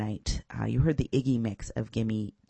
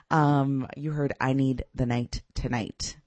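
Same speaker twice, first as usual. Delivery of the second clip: ordinary talk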